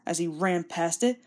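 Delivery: usual speaking voice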